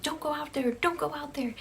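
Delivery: lightly acted scared girl begging; anxious pleading. Shannon and Tammy pulling on her shirt, anxious pleading.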